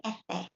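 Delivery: "F" standing for "ese" lisped as "ethe"; soft TH for S. Shown as a demonstration. Teacher showing some countries' accent, "F" as lisped "ethe". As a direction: say the letter name with TH for S. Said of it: "like a soft T H".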